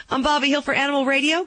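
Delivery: quick, bright and rising; upbeat sign-off. Acted. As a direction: toss it off brightly and fast. News sign-off after laughing fit.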